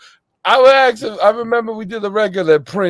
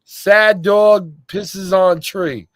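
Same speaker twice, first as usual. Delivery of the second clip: very slow, drawn-out announcement; slow deadpan title; 3 semitones higher, much slower than usual. Retold or imitated. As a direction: announce the silly title slowly.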